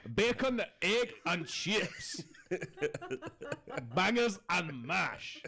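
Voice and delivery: high, slow Spanish take on English; hammed-up Spanish waiter attempting an English accent; Spanish-tinged English accent. Spanish staff serving English tourists breakfast, mock Spanish-English accent. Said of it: "like, an English accent".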